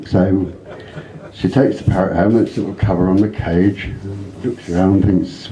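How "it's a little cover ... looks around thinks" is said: ordinary delivery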